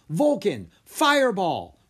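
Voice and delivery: loud, high shouted command; commanding cry. Acted. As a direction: call the dragon to fire. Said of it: "Rory cried".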